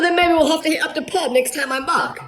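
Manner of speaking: high-pitched mock British accent; put-on British accent. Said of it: "I was really trying to sell it".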